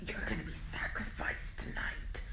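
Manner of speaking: hushed, whispery demonic threat; eerie whispered possession act; possessed voice. Retold or imitated. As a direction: imitate someone possessed, mostly under the breath. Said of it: "talking in, like, a possessed voice".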